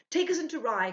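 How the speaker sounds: panicked cry for help; cried out. A narrator's reading. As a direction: call out to the carrier in panic. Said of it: "cried Ludwig".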